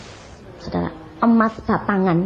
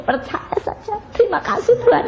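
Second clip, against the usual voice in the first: whispered then high, breaking into tears; tearful prayer; 11 semitones higher than usual. Acted. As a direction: pray through tears, whisper then cry out. (doa sambil menangis, berbisik lalu tinggi)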